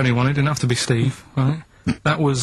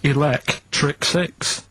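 slow drawn-out; much slower than usual. Acted. Answer to the host, slow and drawn out. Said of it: "don't say it like that".

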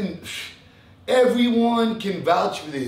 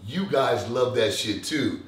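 ordinary talk, nothing marked

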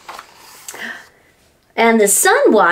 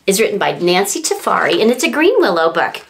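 usual speaking voice

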